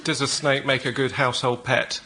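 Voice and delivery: ordinary delivery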